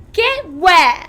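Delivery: Veruca, bratty and curious; spoilt girl asking. Acted. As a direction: demand it impatiently. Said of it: "asked Veruca Salt".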